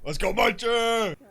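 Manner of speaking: high, loud whooping yell; excited cheer. Pretend. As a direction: shout it like a hype cheer. Cheering on in the game.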